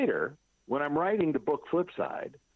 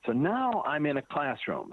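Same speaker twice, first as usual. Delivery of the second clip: ordinary talk, nothing marked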